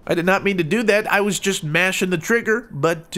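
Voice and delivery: ordinary talk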